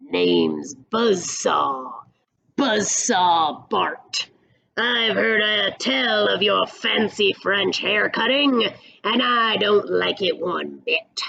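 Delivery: gravel-voiced outlaw, menacing and unhurried; gravelly cowboy villain sizing up the barber; gravelly stranger. A narrator's reading. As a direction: grind it out like gravel under a boot. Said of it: "In a voice that sounded like gravel beneath a boot".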